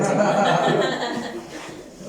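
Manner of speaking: a burst of high, loud laughter. Real, not acted. Laughing at his old resentment toward God. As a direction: laugh out at your own bitterness.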